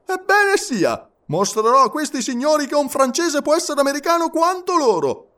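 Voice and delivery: very high, emphatic declaration; defiant resolve. A narrator's reading. Passepartout answering the taunt of fear, defiant.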